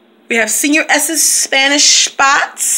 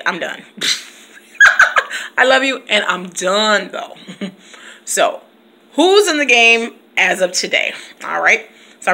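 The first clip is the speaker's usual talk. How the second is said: usual speaking voice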